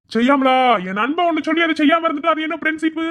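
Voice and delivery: high, loud triumphant boasting; proud friend bragging over the phone; boastful brag. Acted.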